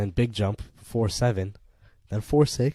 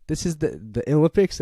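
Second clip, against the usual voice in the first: loud, high patriotic shout; pumped-up fan yelling; excited outburst; 7 semitones higher, 8 dB louder than usual. Real, not acted. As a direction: cheer for your country at full voice.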